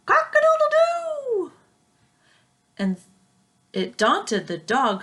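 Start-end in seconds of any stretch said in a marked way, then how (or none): 0.1-1.5 very high, loud held crow; crowing rooster. Acted. Flustered fowl crowing after the sneeze, high and loud.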